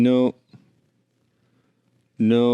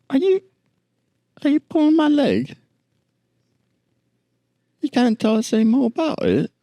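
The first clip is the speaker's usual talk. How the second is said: "it is what it is" repeated much higher, in a squeaky disbelieving tourist voice; incredulous tourist; faster than usual. Acted. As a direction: echo the guide in a high, baffled voice. Tourist pressing the guide.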